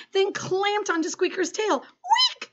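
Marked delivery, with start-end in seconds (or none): 1.8-2.5 tiny, very high-pitched squeal; piercing little squeak; mouse squeak. A narrator's reading. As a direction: squeak it like a startled pet mouse. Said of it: "squeaked the squeakers".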